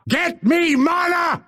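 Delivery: high-pitched yell; furious demand. Acted. Fighter ordering ally for mana, furious shout.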